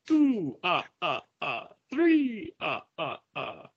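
high vampire count, ah ah ah; Count-style counting. Pretend.

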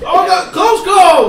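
high, loud, slow alarmed cry; panicked shout. Acted. Players voice frightened townsfolk dodging a cart. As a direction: yell it like you nearly got run over.

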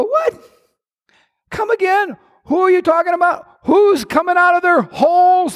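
indignant retort. Acted. Acting indignant reply.

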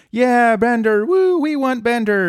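high cheering whoop. Retold or imitated. Fry and Leela cheering Bender from outside, high whoop. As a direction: cheer him on like a fan. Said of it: "they're like".

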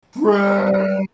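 loud, drawn-out monster bellow; level, booming beast cry; exclaiming creature. Acted. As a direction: roar it out as a happy declaration. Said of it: "looks at you and sniffs and goes".

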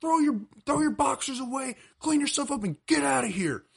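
high-pitched angry father giving orders; outraged dad. Retold or imitated. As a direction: imitate a fed-up dad ordering his kid out. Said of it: "he goes".

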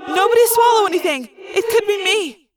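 high, urgent warning; crying out. A narrator's reading. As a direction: warn them in alarm. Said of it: "I cried".

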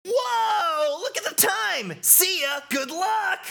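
very high, hurried farewell; squeaky hyped goodbye; excitable game fanatic. Acted. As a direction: call it out way up high. Voices Shooter.

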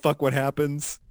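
ordinary talk, nothing marked

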